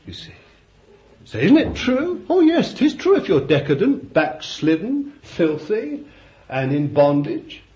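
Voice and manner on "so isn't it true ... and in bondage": high, loud, hammering each word; preaching at full force. Acted. Preacher denouncing a backslidden nation, high and loud. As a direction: pile up the sins from the pulpit. Preacher answers his own question.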